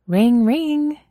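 excited girl mimicking a phone ring; Mina fake ringing. A narrator's reading. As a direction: bounce the ring like a giddy girl. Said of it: "Mina repeated".

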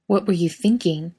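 mild pressing interrogation; pushing. A narrator's reading. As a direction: push for an answer, a touch exasperated. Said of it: "Dean pushes".

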